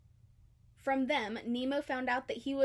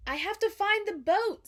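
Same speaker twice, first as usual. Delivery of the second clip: desperate Marlin; 9 semitones higher, 7 dB louder than usual. A narrator's reading. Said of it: "said Marlin".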